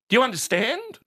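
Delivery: high, pointed check; talking down. Retold or imitated. Father checking son follows, talking down. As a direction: ask as if addressing an idiot. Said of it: "he spoke to me like I was an idiot".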